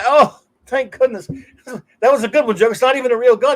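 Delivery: high, loud, giddy relief; relieved henchman. Retold or imitated. Henchman relieved the Joker's gun is fake, high and giddy. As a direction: laugh off the scare, high and bright. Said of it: "And so he goes".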